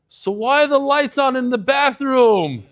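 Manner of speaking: put-on high, loud, slow indignant old man, laughing through it; outraged about the wasted electricity; dying miser's punchline. Dying man to his gathered family, shrill loud indignation.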